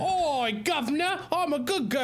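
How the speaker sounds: high-pitched fake Cockney girl; mock Cockney. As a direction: squeal it in a hammed-up Cockney. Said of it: "Do a better accent than that".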